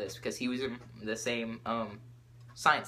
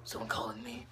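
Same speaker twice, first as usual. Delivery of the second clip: soft, husky put-on teacher voice; Batman; 7 dB quieter than usual. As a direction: growl it quietly like a caped hero. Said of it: "like, a Batman voice".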